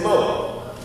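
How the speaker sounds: "mo" with no final r, slow; dropped R. Shown as a demonstration. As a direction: end "mo" on the open vowel, no r. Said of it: "Instead of saying more, we said".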